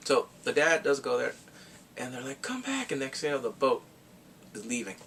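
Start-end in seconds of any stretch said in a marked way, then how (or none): 2.4-2.9 high, soft call after someone; light mock distant cry; imitated calling out. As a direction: call him back in a small high voice. Acting out family calling to dad.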